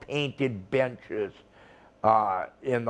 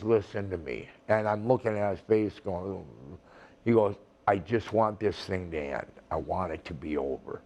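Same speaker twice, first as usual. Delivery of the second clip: quiet plea; 7 semitones lower, 7 dB quieter than usual. Retold or imitated. Rival calmly asking for peace.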